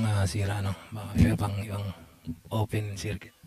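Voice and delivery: ordinary talk, nothing marked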